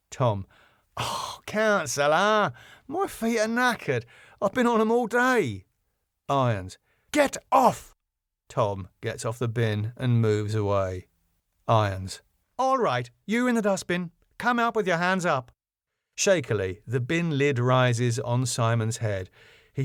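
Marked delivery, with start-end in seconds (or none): 1.0-5.6 plaintive, higher-pitched moaning; whining complaint. Acted. Tom complains at being moved. 7.1-7.9 high-pitched, forceful command; sharp, high exasperated order; angry order. Acted. Irons orders Tom off bin. 12.6-15.5 high-pitched police-style order; firm, high-voiced challenge; stern command. Irons orders hider out.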